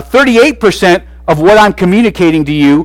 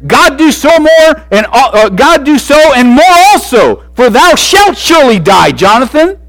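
angry king; 9 semitones higher, 4 dB louder than usual. Acted. Saul's angry oath voiced.

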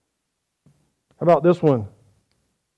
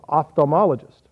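ordinary talk

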